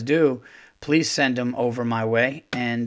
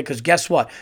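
usual speaking voice

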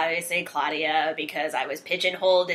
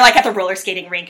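ordinary talk

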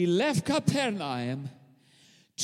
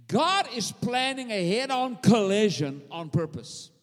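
normal talking voice

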